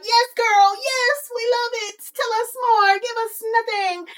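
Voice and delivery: high, hammed-up crowd adulation; cheering fans. The people hyping up her announcement, high and gushing. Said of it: "And the people were like".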